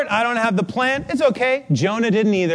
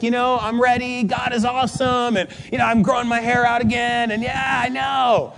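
high, sunny, carefree retelling; so happy; 5 semitones higher than usual. Retold or imitated. Friend with cancer saying she's ready, so happy. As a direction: imitate someone cheerfully at peace. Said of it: "she's just so happy".

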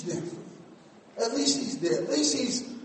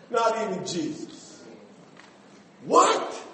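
ordinary talk, nothing marked

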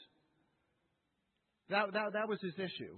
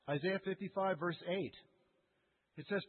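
ordinary talk, nothing marked

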